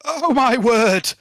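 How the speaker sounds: loud, slow astonished cry; shocked exclamation. Real, not acted. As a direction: gasp it out in disbelief. Player reacts to a roll.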